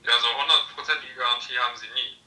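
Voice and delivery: usual speaking voice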